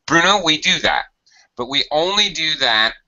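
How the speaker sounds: ordinary delivery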